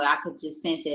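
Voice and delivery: ordinary talk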